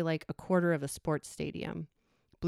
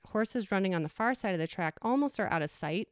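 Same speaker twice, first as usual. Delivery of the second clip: ordinary talk, nothing marked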